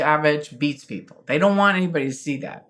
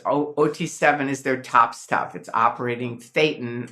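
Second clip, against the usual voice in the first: ordinary talk, nothing marked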